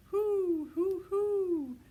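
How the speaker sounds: high, loud owl calls; loud owl hoot. A narrator's reading.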